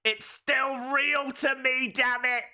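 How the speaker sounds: high, slow imitation of a tearful wrestling fan; tearful passionate outburst. Retelling the upset fan's clip, mock-tearful outburst. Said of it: "he starts crying, and he's like".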